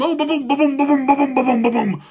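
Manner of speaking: loud rhythmic ba-boom stomps; booming footsteps. A narrator's reading. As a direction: pound out the giant's running steps. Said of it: "the giant chased them".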